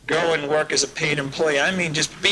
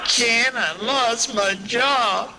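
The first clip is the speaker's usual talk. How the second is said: high, slow, mock whimpering plea; whining caller; 6 semitones higher, much slower than usual. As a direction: play a whiner crying for help, slowed and higher. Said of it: "whimpers and whiners".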